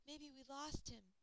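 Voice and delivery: usual speaking voice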